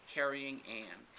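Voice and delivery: plain reading voice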